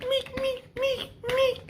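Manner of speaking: repeated high-pitched "meek" noises; high squeaky hit noise. Retold or imitated. Storyteller imitating blows landing, high squeaky hit sounds. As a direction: squeak out each blow, very high.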